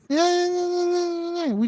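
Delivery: very slow, high, dreamy plea; drawn-out longing sigh. Acted. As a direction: stretch every word out wistfully. Husbands wanting peace at home.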